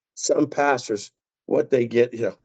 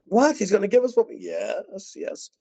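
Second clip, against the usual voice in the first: mock surprise; 10 semitones higher than usual. Congregation reacting with surprise.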